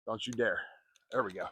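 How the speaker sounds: usual speaking voice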